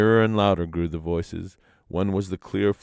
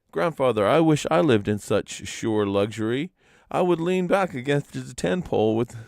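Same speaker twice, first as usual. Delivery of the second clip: wistful sigh; 6 semitones higher than usual. A narrator's reading. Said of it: "sighed Manston".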